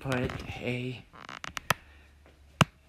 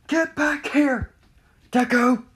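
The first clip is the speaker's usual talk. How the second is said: high, loud angry shout; high-pitched furious holler; chasing yell; 12 semitones higher, 9 dB louder than usual. Acted. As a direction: yell after someone running away. Acted yelling while chasing.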